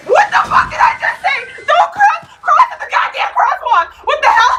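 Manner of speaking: high, loud scolding at the screen; shrill-pitched outraged shouting; exasperated yelling. Real, not acted. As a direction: yell at them like they ignored you.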